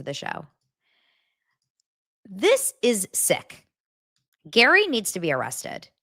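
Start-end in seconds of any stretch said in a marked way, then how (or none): 2.3-3.7 slow, weighty disgust; dramatic verdict. Real, not acted. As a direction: lean in, let each word land. Slow dramatic true-crime verdict.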